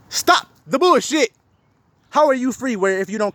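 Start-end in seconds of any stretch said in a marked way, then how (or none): none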